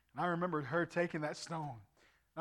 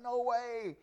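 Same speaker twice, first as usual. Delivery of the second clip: higher, disbelieving retold reaction; amazed mom; 7 semitones higher than usual. Preacher imitates mom hearing he will preach, high disbelief. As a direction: imitate surprise with raised pitch.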